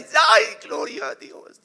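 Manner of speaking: toe stepped on in the metro, yelping; pained cry. Acted. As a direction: shout through the pain, then praise God. Someone steps on ingrown toenail.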